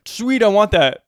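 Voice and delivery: eager, high and loud; excited kid. Retold or imitated. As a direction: kid wanting the toy. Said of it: "and I was like".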